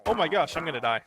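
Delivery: loud panicked cry. Real, not acted. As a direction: yelp it out in alarm. Attacked by mob.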